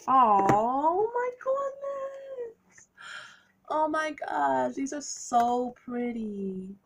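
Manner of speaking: soaring high, slow, drawn-out gasp of wonder; awed delight. Real, not acted. Reader gushing at her pretty cards, high and slow. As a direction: melt over how pretty they are.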